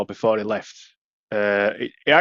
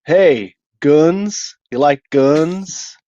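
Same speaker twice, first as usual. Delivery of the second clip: higher, slow mock American; 5 semitones higher, 5 dB louder, much slower than usual. Mocking Americans about guns, slow and higher. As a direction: ham up a gun-loving Yank.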